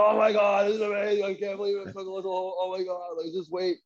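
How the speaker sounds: imitating a golfer freaking out, high and excited. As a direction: gush like a stranger watching his ball almost drop. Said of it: "So he's, like, freaking out".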